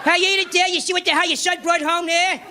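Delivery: gruff Archie Bunker bluster; drunk Archie Bunker. Retold or imitated. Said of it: "he does this".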